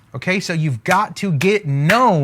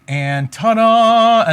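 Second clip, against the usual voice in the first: slow, louder ta-da; showy reveal; 4 semitones higher, 4 dB louder, much slower than usual. Acted. Coach revealing the coffee shop drawing, showy and slow. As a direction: unveil it like a magic trick.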